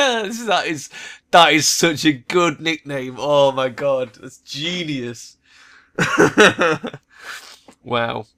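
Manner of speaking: breaking up with laughter; words squeezed out through laughter; laughing hard. Real, not acted. Player cracking up at the troll's nickname, laughing.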